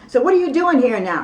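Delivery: hollered demand, high and very loud; David Miscavige. Retold or imitated. Church leader demanding answers from a visitor, hollering. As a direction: imitate an angry boss hollering a question. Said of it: "And he's, he's hollering".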